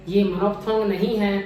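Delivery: ordinary delivery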